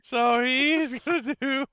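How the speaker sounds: slow, loud, high words broken by laughter; laughing while talking. Real, not acted. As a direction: try to narrate while cracking up. Still cracking up over the goading.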